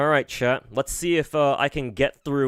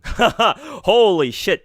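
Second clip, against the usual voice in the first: slow, high, loud laugh through the words; 9 semitones higher, 7 dB louder, much slower than usual. Real, not acted. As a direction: burst out laughing. Laughing outburst.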